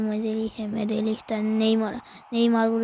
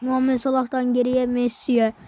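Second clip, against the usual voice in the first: ordinary delivery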